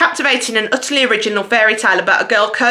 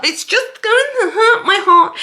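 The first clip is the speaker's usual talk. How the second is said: mock heartbreak; 10 semitones higher than usual. Mock heartbreak over a book.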